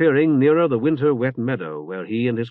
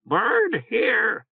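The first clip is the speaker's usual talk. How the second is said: high croaking bird squawk; hoarse bird voice; 10 semitones higher than usual. Acted. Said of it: "A hoarse voice croaked".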